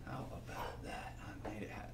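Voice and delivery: soft whisper of small triumph; a quiet whisper off-mic; whispered aside. Real, not acted. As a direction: breathe it out to yourself. Clasp finally done, muttered aside.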